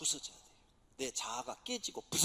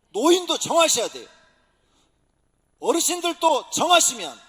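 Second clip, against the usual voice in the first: loud, high preaching at full force; booming revival preacher; fiery preaching; 8 semitones higher, 15 dB louder than usual. Acted. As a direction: preach it out to the whole church.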